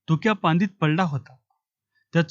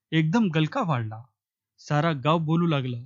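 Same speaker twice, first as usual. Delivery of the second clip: usual speaking voice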